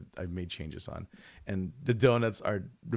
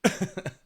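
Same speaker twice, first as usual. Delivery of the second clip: sudden high-pitched giggle; high laugh; 11 semitones higher, 4 dB louder than usual. Real, not acted. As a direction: burst into a squeaky laugh. Laughs after imitation.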